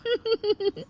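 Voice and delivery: a short high nervous giggle; awkward laugh. Acted. Daughter-in-law laughing awkwardly at the neighbour, high giggle. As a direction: laugh it off, embarrassed.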